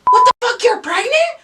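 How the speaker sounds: loud, high disbelieving shout; imitating a brother's stunned yell; shocked brother. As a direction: burst out in acted shock. Brother's shocked reaction acted out.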